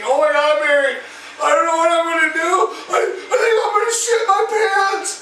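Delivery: mock tearful panic climbing high; hammed-up whimpering panic, rising in pitch; freaking out. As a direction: imitate a wife near tears in desperation. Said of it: "she starts fucking freaking out".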